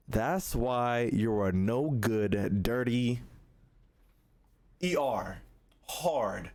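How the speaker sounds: slow, low, deliberate retold insult; said with his chest. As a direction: spell out the insult slowly and low, imitating him. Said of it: "He meant it with his chest".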